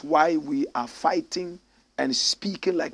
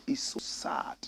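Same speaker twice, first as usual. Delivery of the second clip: soft unvoiced sigh of sorrow; whispered sadness; 3 semitones lower, 9 dB quieter, slower than usual. Real, not acted. Host lamenting low teacher pay, whispered sadness. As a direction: breathe it out sadly, barely voiced.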